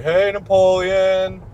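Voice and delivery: long drawn-out call, high and loud; distant shout. Retold or imitated. Students hailing him across the campus square, imitated shout. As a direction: holler a slow greeting across a distance. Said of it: "people would shout out from the other side".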